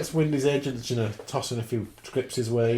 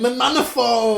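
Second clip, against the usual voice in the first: high, loud mock scream; retold scream; 11 semitones higher, 7 dB louder than usual. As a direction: yell it like a panicked car. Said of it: "screaming, he's going".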